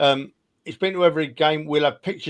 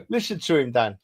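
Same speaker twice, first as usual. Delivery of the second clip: laughing; 3 semitones higher than usual. Real, not acted. Teasing a friend, laughing.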